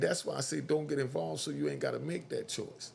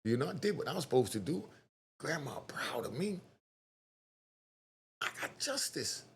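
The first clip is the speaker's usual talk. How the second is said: hushed defendant voice; 6 dB quieter than usual. Retold or imitated. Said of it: "And he said".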